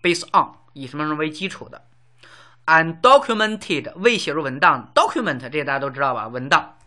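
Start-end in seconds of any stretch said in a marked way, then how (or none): 2.6-3.9 Undocumented spelled out slowly and loudly, syllable by syllable; slow, clear model word. Shown as a demonstration. Teacher slowly modelling Undocumented for learners, drawn-out enunciation. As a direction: stretch out Undocumented for students. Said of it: "teaching vocabulary".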